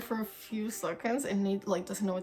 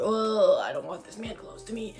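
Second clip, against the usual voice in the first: disgusted inner voice. Retold or imitated. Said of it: "I felt so uncomfortable".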